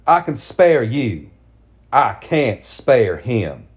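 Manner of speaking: slow, cruel, deliberate; cold and slow. Acted. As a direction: say it coldly, slowly. Mother's cruel closing words.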